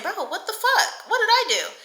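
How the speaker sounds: high, incredulous squeal of retold shock; stunned disbelief. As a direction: replay the moment the text landed.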